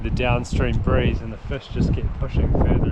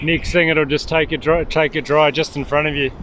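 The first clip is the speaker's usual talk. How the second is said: loud, higher encouragement to the angler; raised voice carrying to a buddy; calling out; 4 semitones higher, 7 dB louder than usual. Real, not acted. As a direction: call it across the river.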